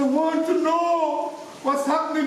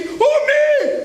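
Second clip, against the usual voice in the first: high, loud startled shout; shouted back; 13 semitones higher, 5 dB louder than usual. Acted. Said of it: "I shouted back".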